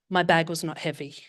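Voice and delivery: normal talking voice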